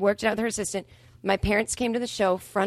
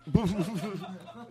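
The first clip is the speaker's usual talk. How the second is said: laugh; 5 dB quieter than usual. Real, not acted. Laughing at the punchline.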